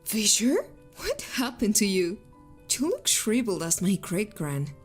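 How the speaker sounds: startled fae woman. A narrator's reading. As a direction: gasp at how old he got. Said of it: "gasped as though startled".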